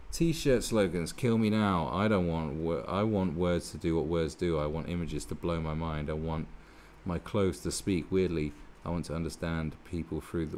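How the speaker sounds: sneering recital of an op-ed; mocking article reading. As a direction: read the columnist's words like a dreary sermon. Reads the article aloud mockingly.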